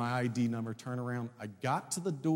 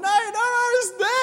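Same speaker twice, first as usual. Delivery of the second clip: high, loud blubbering wail; mock crying; 13 dB louder than usual. Husband on the phone to his wife, wailing through tears. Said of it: "I was crying".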